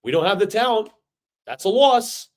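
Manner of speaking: high, loud whiny mock of fans making excuses. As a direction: sneer it out like a defeatist fan. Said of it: "us Jet fans ... are like".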